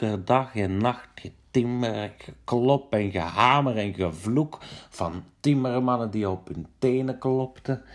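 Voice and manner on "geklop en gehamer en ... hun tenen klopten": ordinary delivery, nothing marked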